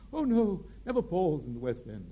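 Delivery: high, loud, grand theatrical storytelling; Gielgud's grand tale. Retold or imitated. Sir John telling the actor why, grand and loud. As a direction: spin it like a grand old actor. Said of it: "Gielgud's anecdote".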